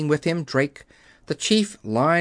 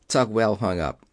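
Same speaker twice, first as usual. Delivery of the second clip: ordinary delivery, nothing marked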